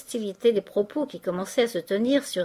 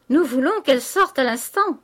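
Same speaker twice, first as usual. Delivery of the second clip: loud, higher-pitched group demand; shouted demand; 5 semitones higher, 6 dB louder than usual. A narrator's reading. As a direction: call it out as an outraged lodger. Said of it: "crièrent les pensionnaires".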